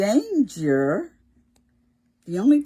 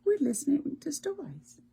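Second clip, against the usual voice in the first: whisper; 9 semitones higher than usual. Real, not acted. Hushed aside to children.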